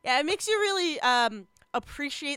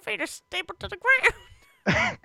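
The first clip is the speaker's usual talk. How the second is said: squeaky pleading little otter; Emmett Otter voice; 6 semitones higher, 4 dB louder than usual. Retold or imitated. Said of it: "That's my Emmett Otter impression".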